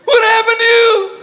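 high, loud freaked-out exclaim; a panicked nurse at the desk; alarmed receptionist. Retold or imitated. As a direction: shriek it like she's panicking. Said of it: "when they're freaking out".

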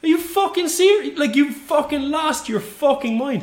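high-pitched exasperated outrage; indignant disbelief, pitch way up; retold incredulous rant. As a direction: act out the tirade you kept in your head. Replays his outraged inner rant.

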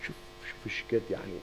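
whispered, fast; hushed wonder to the listeners; awed whisper. Real, not acted. Awed aside to the audience. (همس متعجب وسريع)

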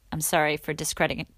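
ordinary talk

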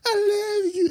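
very high, thin mock dying voice; dying mother. Anakin's mom dying to her son, mock dying voice. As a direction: imitate a mother's last tearful words in falsetto. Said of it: "she's dead".

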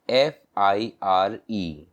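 "एफ़ आई आर एम" spelled slowly, letter by letter; slow spelling. Shown as a demonstration. Teacher spelling for learners, slow letter by letter. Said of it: "fire".

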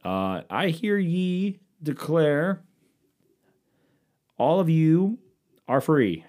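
slow, high, grand town-crier reading; drawn-out old-time decree voice; old-timey proclamation. Acted. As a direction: unfurl the scroll and declare it like a herald. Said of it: "Some old-timey shit".

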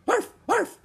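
very high, loud yapping arf arf; small dog barking. A narrator's reading. Teddy the dog barking happily, very high yaps. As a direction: yap like a little puppy.

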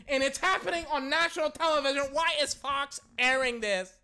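high, loud comic yelling; hollering mock outrage at the screen; excited outrage. Real, not acted. Fan yelling that a tackle is a murder.

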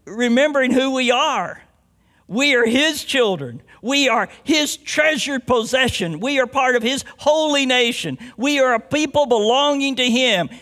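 emphatic preacher proclamation; forceful preaching. Real, not acted. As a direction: preach it with rising conviction.

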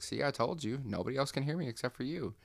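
high, smug told-you-so; smug coffee voice. Retold or imitated. The coffee cup gloats to the patient, high and smug. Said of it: "the coffee's like".

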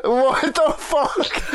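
high, drawn-out words through laughter; laughing disbelief. Real, not acted. Listener reacts to a filthy joke, laughing in disbelief. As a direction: crack up in real disbelief.

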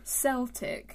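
"Celtic" said with an S sound at the start; soft C. Shown as a demonstration. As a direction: say "Celtic" as SEL-tik, the football club. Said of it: "Why are they pronounced differently".

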